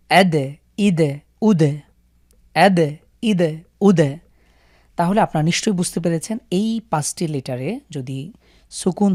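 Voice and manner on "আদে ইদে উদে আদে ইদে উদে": দ in আদ, ইদ, উদ given an echoing bounce, slow; slow qalqalah drill. Shown as a demonstration. Teacher slowly drilling the bounced দাল for students. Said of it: "দাল দিয়ে যদি আমরা একটু পড়ি".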